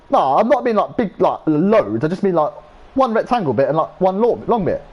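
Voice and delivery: high, whiny, over-eager explanation; squeaky explaining friend. Retold or imitated. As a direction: squeak out the excuse like a nervous teen. Retold friend explaining himself.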